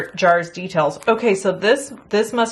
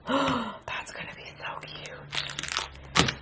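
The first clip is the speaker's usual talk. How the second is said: excited whisper; 5 semitones higher, 12 dB quieter, much slower than usual. Real, not acted. Gasp at a cute wishing well.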